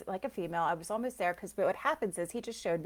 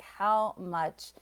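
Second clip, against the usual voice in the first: normal talking voice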